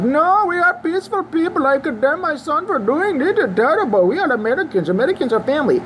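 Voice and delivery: high, put-on voice for the shooter's dad; mocking the father's statement; mock father on the news. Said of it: "His daddy gets on the news and is like".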